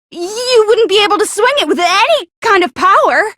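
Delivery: ordinary talk, nothing marked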